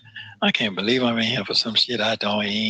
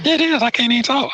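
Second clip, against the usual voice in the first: much higher and louder, fed up; exasperated outburst; 13 semitones higher, 4 dB louder than usual. Real, not acted. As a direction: blurt it out high and loud in frustration. Complaining he can't use phone.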